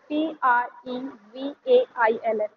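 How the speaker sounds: slow letter-by-letter spelling; spelled out. Shown as a demonstration. Teacher spells the word for the class, slowly. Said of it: "प्रेवल्स का मतलब होता है".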